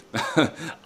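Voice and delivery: tired little laugh. Real, not acted.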